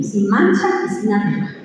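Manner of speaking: usual speaking voice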